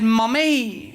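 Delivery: put-on high, drawn-out child's "Mummy"; child calling mum. Daughter to mum in the car, child calling out.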